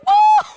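high loud cry of pain; pained shout. Acted. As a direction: yelp as the blow lands. Teeth knocked out.

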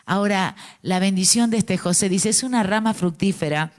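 usual speaking voice

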